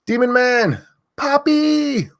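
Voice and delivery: loud high name shout; screaming names. Real, not acted. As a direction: belt the viewer's name out. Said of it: "I have to stop screaming that".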